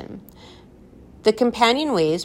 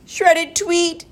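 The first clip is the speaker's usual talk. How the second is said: high, pleased delivery; gleeful punchline; 12 semitones higher, 4 dB louder than usual. A narrator's reading. Professor caps his own bird joke, gleeful. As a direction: pop it out, tickled by it.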